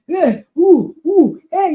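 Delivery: loud mock yelling at a child; imitating a screaming parent. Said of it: "you are just there screaming".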